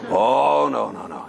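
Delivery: drawn-out oh, then low refusals; imitated wary protest, dropping in pitch; reluctant student. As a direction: play a student hastily backing off. Student refuses to hand over wallet.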